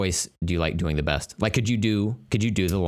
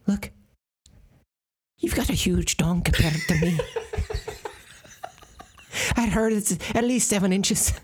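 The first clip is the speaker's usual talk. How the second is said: high giggly nanny voice; Mrs. Doubtfire voice; 10 semitones higher than usual. Acted. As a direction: coo it like a giddy old nanny. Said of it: "a Mister Missus Doubtfire type of voice".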